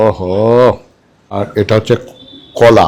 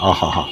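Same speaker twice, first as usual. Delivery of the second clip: savoring sigh; 5 semitones lower, 9 dB quieter than usual. Real, not acted. Savoring soft mutton.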